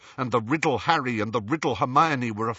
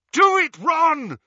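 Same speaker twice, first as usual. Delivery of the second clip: high, loud urgent yell; 8 dB louder than usual. Acted. Harry urging Ron as the sword is raised, yelling. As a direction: yell it as a command. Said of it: "Harry yelled".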